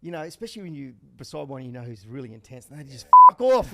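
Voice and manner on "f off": high, loud snapped swear; mock angry shout. Intense rival jockey snapping at a teasing rider, loud angry swear. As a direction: spit it at the chatterbox.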